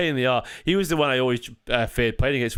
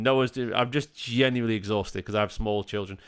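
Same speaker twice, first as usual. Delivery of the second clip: ordinary talk, nothing marked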